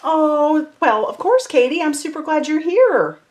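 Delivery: light up to greet Katie; high excited hello to a viewer. Real, not acted.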